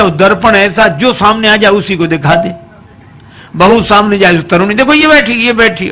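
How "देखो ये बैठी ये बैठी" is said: 높은 목소리로 흥분해 가리키며; excited pointing. Acted. Wife pointing at mirror.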